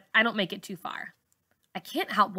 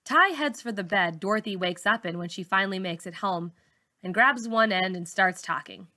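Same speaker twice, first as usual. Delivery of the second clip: usual speaking voice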